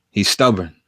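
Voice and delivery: ordinary talk, nothing marked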